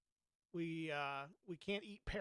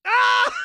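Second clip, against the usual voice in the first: sudden high shriek; startled scream; 23 dB louder than usual. Real, not acted. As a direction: scream at the loss, very high and loud. Dies in game, screams.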